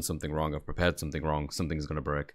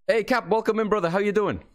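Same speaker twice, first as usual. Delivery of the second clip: high, bright welcome to a viewer; excited greeting; 12 semitones higher, 3 dB louder than usual. Real, not acted. Streamer welcoming Cap into chat, high excited greeting. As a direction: call out a happy hello.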